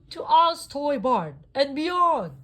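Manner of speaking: high, loud, slow heroic battle cry. A narrator's reading. Buzz leading toys, high loud slow heroic cry. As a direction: proclaim it like a space hero. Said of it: "he cried".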